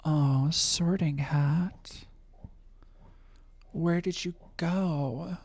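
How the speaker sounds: slow breathy ASMR whisper. Pretend. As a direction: whisper it slowly, drawn out. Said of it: "a little A S M R".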